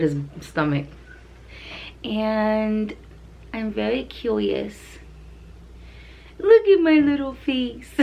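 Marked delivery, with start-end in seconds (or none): 2.0-7.9 high, slow cutesy puppy voice. Pretend. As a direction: speak for the dog like a sweet baby pup. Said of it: "Say hi".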